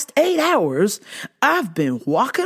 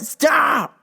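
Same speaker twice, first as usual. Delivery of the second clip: sudden high shouted command; stretched, exasperated holler; 5 semitones higher than usual. Real, not acted. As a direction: cut them off with a yell. Orders the suck-ups to stop.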